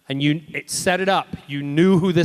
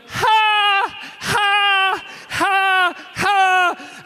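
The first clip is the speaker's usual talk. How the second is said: pained gasps; much slower than usual. Retold or imitated. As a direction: gasp out hurt breaths while limping. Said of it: "he's walking around going".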